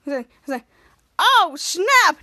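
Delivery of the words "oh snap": loud, drawn-out mock reaction; retold exclamation. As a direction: exclaim as the watching character.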